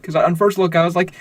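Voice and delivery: ordinary talk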